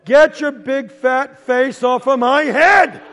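slow, loud, high order; exasperated shout. Acted. Kid pinned under brother, slow furious mock shout. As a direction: punch out each word in outrage. Yelling at brother lying on him.

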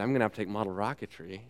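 soft, quick mumbled thought; quiet inner thought. Retold or imitated. Girl's hesitant thought on the dock, softly muttered. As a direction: mutter it fast under the breath.

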